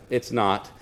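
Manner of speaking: very low, quiet deadpan; understated, final contradiction; deadpan reply. Retold or imitated. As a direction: drop low and soft, unbothered. Deadpan reply in retold argument.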